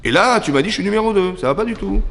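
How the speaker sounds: put-on a touch of childish pique; vexed. Caïn told he is number two, vexed. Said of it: "Et je me vexe".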